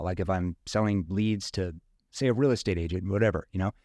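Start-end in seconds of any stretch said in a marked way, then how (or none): none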